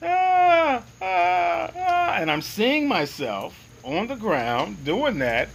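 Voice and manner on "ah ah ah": high, repeated "ah" cries; mock yell. Man choosing to go on yelling, high mock yell. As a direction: yell it high in sustained bursts. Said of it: "So I continued to yell".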